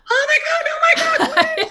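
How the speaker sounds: very high-pitched frantic dog voice; mock hyper-excited squealing. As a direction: squeal it as a wildly overexcited dog. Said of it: "much more excited".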